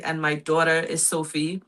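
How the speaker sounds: normal talking voice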